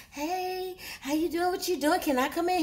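light, high-pitched coy voice; coy greeting. Retold or imitated. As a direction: imitate a woman sweetly angling to come in. Woman greeting man at hotel door.

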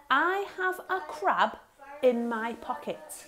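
slow, deliberate dictation; dictation pace. Shown as a demonstration. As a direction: dictate for the children to write. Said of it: "So I'd like you to write".

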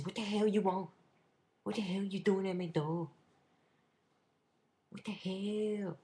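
high, loud mock outrage in a put-on accent; indignant accented outburst. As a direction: yell it indignantly in a comic accent. Acted confrontation at the door, in accent.

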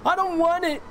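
high-pitched whiny mock voice; squeaky high voice. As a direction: squeak it out like a silly cartoon. Repeats line in squeaky voice.

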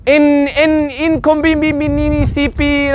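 inconveniente stuttered into many extra syllables; mock a learner stumbling slowly through inconveniente; botched learner Spanish. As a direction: inconveniente as "in-in-inconvee-vi-ni-ni-see-pee". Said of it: "Then they try to say it in Spanish".

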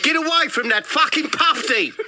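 high, angry South African yell; South African shout. Acted. Kiosk bloke warns tourist off a penguin, South African shout. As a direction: bellow a warning in broad South African. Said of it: "this voice, South African".

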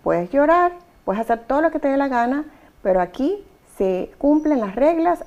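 loud and high, laying down the law. Shown as a demonstration. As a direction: raise your voice firmly over a screaming child. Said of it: "usted simplemente diga". (voz alta y firme, poniendo límites)

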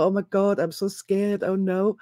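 half-hearted scared whimper; mild mock fright. Mother of two in a riot, mildly mock scared. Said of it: "Thrash about and go".

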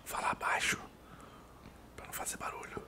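hushed whisper to stay unheard; whispering while hiding. Real, not acted. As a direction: whisper so nothing hears you. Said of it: "Fazer o mínimo de barulho possível".

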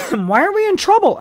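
truly not understanding; puzzled. A narrator's reading. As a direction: Helene puzzled by the arrest. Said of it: "asked Helene, puzzled".

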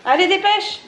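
high, loud hurry-up call. Real, not acted. Hurrying child along.

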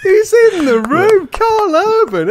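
high, loud hype; mock amazed. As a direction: announce a star guest with rising excitement. Gushes at co-host's impression.

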